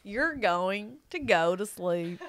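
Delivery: slow, soothing bedtime narration; sleep story voice. Retold or imitated. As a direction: lull them to sleep, slowly. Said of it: "one of those sleep time".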